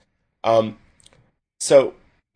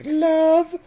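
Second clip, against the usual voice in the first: very high, slow mock girly voice; much slower than usual. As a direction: coo the sign-off in a sugary falsetto. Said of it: "and I was like".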